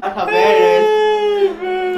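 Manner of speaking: high, slow, loud cry of pain. Real, not acted. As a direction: wail from the burning heat. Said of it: "My nose is on fire".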